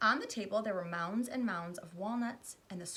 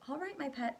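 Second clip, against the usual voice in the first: soothing; 7 semitones higher than usual. Acted. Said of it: "Missus Salt said soothingly".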